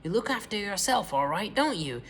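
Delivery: gloating cackle. A narrator's reading. Grandma catching George with the cup, high gloating cry. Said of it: "she cried".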